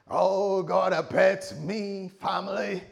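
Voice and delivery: loud, drawn-out, high American cry; American accent. Acted. Abraham announcing to his family, hammed-up American accent. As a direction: belt it out slowly in a put-on American accent. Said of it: "Sorry, that's my American".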